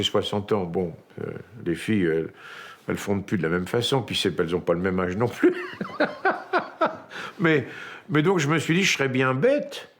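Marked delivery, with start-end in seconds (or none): 5.6-7.3 a short high-pitched burst of laughter. Real, not acted. Laughing at his own joke about age, high laugh.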